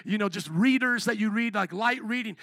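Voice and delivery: usual speaking voice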